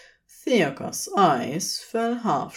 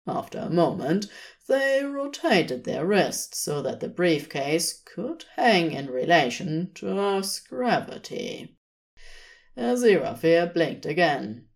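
ordinary delivery, nothing marked

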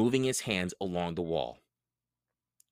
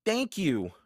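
calling out; 9 semitones higher than usual. A narrator's reading. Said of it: "called Annie".

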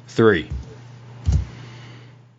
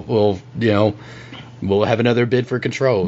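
ordinary talk

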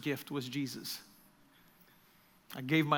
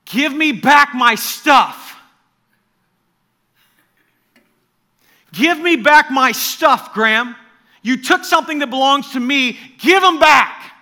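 loud, high pleading command, slowly; intense demand, not angry; 10 semitones higher, 17 dB louder, slower than usual. Retold or imitated. Jesus to Graham in a dream, loud intense plea. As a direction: demand it back with love, not rage. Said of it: "Remember, not anger in the voice".

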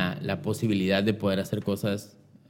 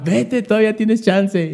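much higher, louder slow urging; excited mom; 12 semitones higher, 6 dB louder, slower than usual. Retold or imitated. Mom tells her son to hurry to the casting, high and excited. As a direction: push him out the door, thrilled.

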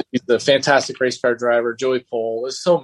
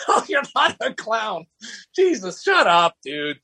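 exasperated reassurance; 9 semitones higher than usual. Retold or imitated. Retold exasperated reply to Patrick.

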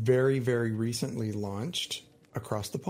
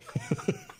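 laugh; 5 dB quieter than usual. Real, not acted. Laughs at the old balls joke.

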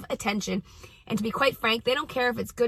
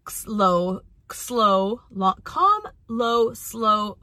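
very slow, separated words; measured demonstration of calm talking; much slower than usual. Shown as a demonstration. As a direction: stretch each word out deliberately. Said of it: "talking in a calm, slow".

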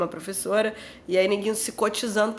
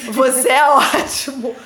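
slow, giggling through it; trying not to laugh; stifled giggles; 5 dB louder than usual. Real, not acted. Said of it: "Eu não tô conseguindo parar de rir".

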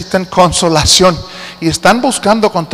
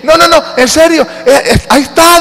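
high, loud insisting; girl pleading that Peter is really there; excited girl; 10 semitones higher, 3 dB louder than usual. Retold or imitated. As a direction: insist breathlessly like a girl nobody believes. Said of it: "dice la niña".

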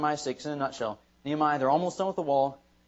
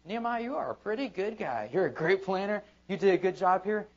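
higher, mock-flattering voice; mock flattery; 6 semitones higher than usual. Pastor mimicking imagined praise of Nehemiah, mock flattering. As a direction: puff up as a flatterer would.

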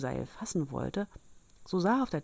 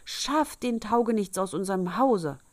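high, agitated complaint; upset wife raising the alarm; loud outcry; 4 semitones higher, 5 dB louder than usual. A narrator's reading. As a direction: wail in outrage, peaking mid-way. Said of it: "eilte dann mit lautem Geschrei".

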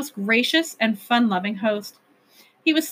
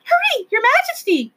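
very high, louder creature squeal; tiny creature urging; 14 semitones higher, 4 dB louder than usual. A narrator's reading. Little creatures tugging the king's slippers, high squeal. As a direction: squeak it out urgently.

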